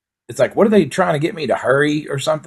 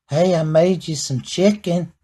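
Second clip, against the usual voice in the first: higher, slow put-on voice of his lady; imitating his lady; 6 semitones higher than usual. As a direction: sweetly offer the chicken, a notch up. Wife offering chicken, voiced.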